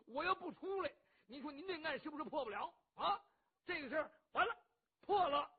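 loud, high brash blusterer voice; a boisterous hothead boasting; rough loud bandit. Acted. Cheng Yaojin tells Qin Qiong he saved him, brash and loud.

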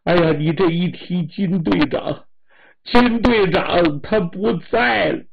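high, loud tearful lament; wife weeping as she breaks the news; crying while speaking. Acted. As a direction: cry the words out. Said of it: "王大友的老婆哭着说".

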